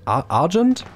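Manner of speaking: Argent restarted as "A-, Ad-jent", ending high; struggling pronunciation. Shown as a demonstration. Streamer testing the name on chat, halting high-rising "Ad-jent" for Argent. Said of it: "ich kann das nicht aussprechen".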